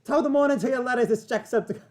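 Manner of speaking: screamed mock-Irish greeting, high and loud; Jacksepticeye. Co-host imitating an Irish YouTuber's opening, screamed Irish accent. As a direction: yell an exaggerated Irish stream intro. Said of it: "he screams it".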